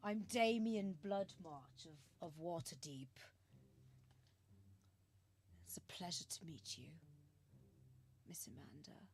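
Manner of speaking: lightly voiced suitor, trailing into a hush; suave introduction. Damien greets Amanda with a carrot rose, hushed courting voice. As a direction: introduce yourself softly, almost whispering by the end.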